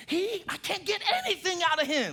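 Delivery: high-pitched, exasperated mock complaint; acted grievance, voice pitched right up; complaining spouse. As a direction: whine like a fed-up spouse. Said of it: "I've heard spouses complain about that".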